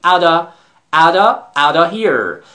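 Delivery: "out of" said as "outta"; reduced "out of". Shown as a demonstration. Teacher modelling for students, "out of" shortened to "outta". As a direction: repeat "out of" linked, with no f sound. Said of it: "Out of رو اینجور بگیم".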